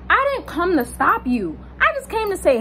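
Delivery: high-pitched, insistent retort; exasperated protest. Retold or imitated. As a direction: plead your case with pitch climbing sharply. Retold protest to the man.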